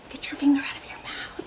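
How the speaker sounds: unvoiced playful whisper. Real, not acted. Mom whispering to her child.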